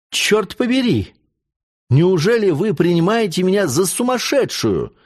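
high-pitched, indignant outburst; fiery exclamation. A narrator's reading. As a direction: protest hotly, pitch jumping up. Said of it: "вскричала Кристина со своей обыкновенной пылкостью". (пылко, возмущённо, высоким голосом)

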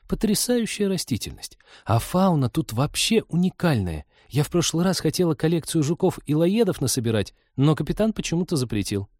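put-on high, eager girlish voice; excited young nature lover; enthusiastic girl naturalist. Voices enthusiastic Polina in story.

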